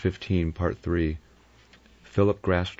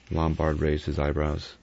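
ordinary delivery, nothing marked

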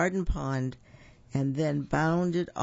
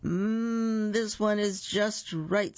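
slow, higher, savoring hum into the words; happy; 6 semitones higher, much slower than usual. A narrator's reading. Goldilocks tastes the third bowl of porridge, happily. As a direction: relish it, drawn out and high. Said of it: "said Goldilocks happily".

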